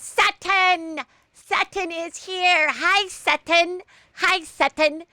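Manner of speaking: high, drawn-out squeal of a greeting; Jennifer Tilly. Acted. Greeting Sutton as she arrives, slow high-pitched impression. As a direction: sing out the name in a very high voice. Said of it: "I've been working on my Jennifer Tilly impression".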